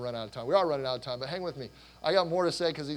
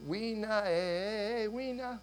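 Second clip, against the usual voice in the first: imitating Kenyan children's song in accented English; higher, chanted Kenyan-accented "weena"; thick Kenyan accent; 6 semitones higher, much slower than usual. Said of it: "but their thick accent".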